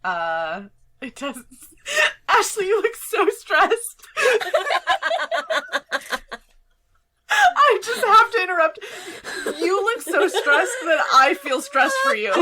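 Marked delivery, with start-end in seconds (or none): 2.3-4.1 cracking up, high and loud; laughing. Real, not acted. Game master breaks off at a stressed-looking player, laughing. 7.3-12.3 loud, high, helpless laughing speech. Real, not acted. Game master interrupts the game over a player's stress, laughing.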